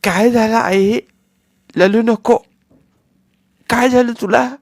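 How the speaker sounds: put-on high, lisping little boy asking worried; childish lisp, anxious and loud; worried toddler voice; small child.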